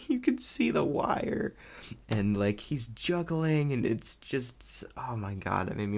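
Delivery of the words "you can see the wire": laughing. Real, not acted. Laughing at the wire gag.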